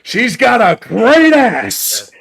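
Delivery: slow hammed-up ogling voice; leering mock voice. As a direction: ham up a lecherous drawl. Mock ogling line in funny voice.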